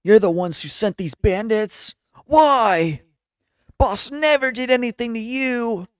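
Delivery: high, loud protesting henchman voice; loud, high accusing character voice; indignant underling. Acted. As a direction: cry out in high-pitched outrage for your boss.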